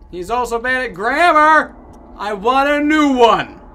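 loud, high, drawn-out whine; mock-outraged demand, stretched out; shouted complaint. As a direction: yell it like a fed-up customer. Player shouts complaint about the buddy.

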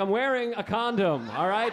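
high, drawn-out mock protest; exasperated protest. Boyfriend asked to protect his girlfriend, exasperated protest. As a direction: plead helplessness, slow and high.

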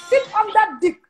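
loud emphatic urging. Real, not acted. Urging women, punchline burst.

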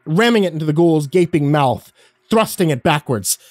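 tense action narration. A narrator's reading.

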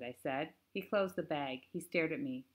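plain reading voice

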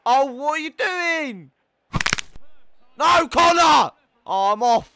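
high, loud yell of disbelief; exasperated shouting. Real, not acted. Gamer scolding his defender Connor, exasperated shouting. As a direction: holler at a useless teammate.

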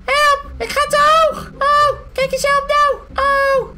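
high, loud shrieks for help; hammed-up, high-pitched alarm; panicked cries. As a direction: squeal in playful panic. Car floats too high, calls help.

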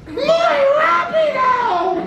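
squeaky high, slow cartoon cry; high cartoon shout. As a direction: yell it like a cartoon girl. [name] voiced as Dora.

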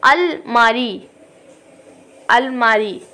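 अलमारी said very slowly with a short अ; slow short a demo. Shown as a demonstration. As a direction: drag अलमारी out slowly for the class. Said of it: "अलिफ". (अलमारी धीरे, छोटे अ के साथ)

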